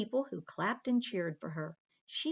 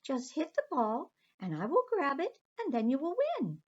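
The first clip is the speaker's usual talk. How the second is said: high, quick sly mermaid; sneaky little mermaid, hurried; 7 semitones higher than usual. Acted. Aqua suggests cheating.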